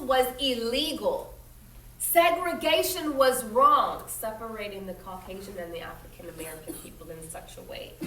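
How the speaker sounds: reading a picture book aloud to the class; steady storytelling voice for children; story narration. A narrator's reading. Teacher reading a history story aloud to her students, in a clear storytelling voice.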